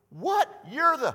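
shrill, loud gasp of surprise; startled recognition. Retold or imitated. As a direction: cry out as it dawns on you. Disciples recognizing Jesus.

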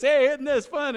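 shouted giddy question; gleeful thrill-seeker. Retold or imitated. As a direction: play the delighted rider yelling over the wind. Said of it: "people are going like this".

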